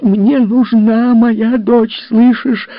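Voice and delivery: high, loud, slow shriek; shrieking demand. Acted. Mother shrieks at her husband. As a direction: scream at him. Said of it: "её голос перешёл в визг".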